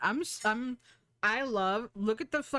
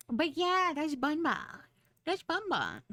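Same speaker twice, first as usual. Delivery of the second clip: high-pitched cutesy squeal of affection; cutesy cooing; 9 semitones higher than usual. Real, not acted. Streamer showing off a beloved character, cutesy cooing. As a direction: coo it high, like talking to a pet.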